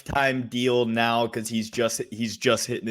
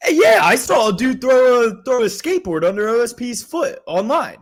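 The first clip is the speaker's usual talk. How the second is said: high, loud burst of disbelief; excited; 11 semitones higher, 5 dB louder than usual. Real, not acted. Co-host telling podcaster about a skateboard thrown at a fighter, excited. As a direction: blurt it out high, amazed by the story.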